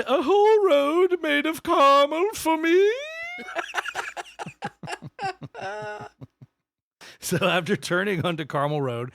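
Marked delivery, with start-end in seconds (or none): 0.1-2.9 squeaky delighted gasp; high, excited voice; giddy high voice. Pretend. Gleeful voiced quote. 2.9-6.4 squealing giggle; high-pitched delighted giggle. Acted. Laugh after voiced quote.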